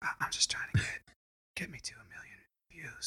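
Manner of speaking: whispered YouTuber greeting; ASMR whisper. Pretend. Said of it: "mocking YouTuber ASMR intro".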